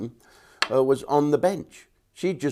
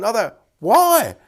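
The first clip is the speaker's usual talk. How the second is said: mock outrage; 10 semitones higher, 9 dB louder, slower than usual. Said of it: "He went".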